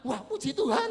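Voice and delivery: loud, high retold rejoicing; jubilant praise. Father hears of son's trip, loud jubilant praise. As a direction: burst out praising God.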